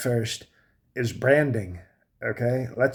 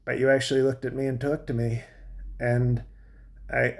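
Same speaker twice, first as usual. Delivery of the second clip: usual speaking voice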